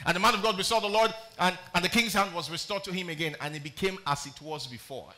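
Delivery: booming Bible reading; scripture reading. Acted. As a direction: declaim to the congregation.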